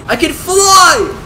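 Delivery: high, loud, drawn-out yell of joy; thrilled cry, stretched long; ecstatic shout. Real, not acted. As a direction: shout it out in amazed triumph. Discovers the bike flies.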